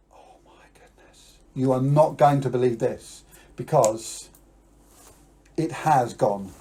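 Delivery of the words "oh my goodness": hushed stage whisper. Acted. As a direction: breathe it in awe. Trick reveal to children.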